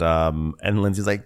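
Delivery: usual speaking voice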